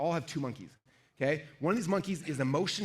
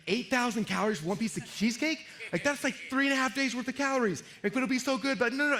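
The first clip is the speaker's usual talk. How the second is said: shocked at calories; 9 semitones higher than usual. Retold or imitated. Seeing the cheesecake calories, a high alarmed mock protest. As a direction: recoil from the number in mock horror. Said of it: "And you're like".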